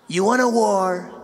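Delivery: slow menacing Trump voice; lightly voiced Trump, slow threat; Trump impression. Trump answering Melania, slow menacing impression.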